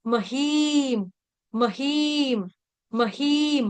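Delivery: महीम with long ii, closing on a hummed m; halant final m. Shown as a demonstration. Teacher drilling the ending, महीम closed on bare m. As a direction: draw out महीम, no vowel after म. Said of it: "म हलंत".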